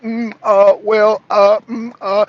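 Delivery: hesitant hemming and hawing; mock flustered um-ing; mock stammer. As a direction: stall for words like a caught politician. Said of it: "Stromer's like".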